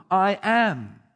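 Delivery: "I am" rung out loud, each word hit separately; emphatic I am. Shown as a demonstration. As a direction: declare "I am" loudly, with full weight on both words. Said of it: "emphatic "I am" statements".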